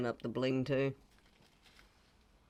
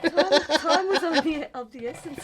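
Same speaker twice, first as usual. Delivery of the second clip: laughing; 13 semitones higher, 7 dB louder than usual. Real, not acted. Laughing excuse after dropped paint.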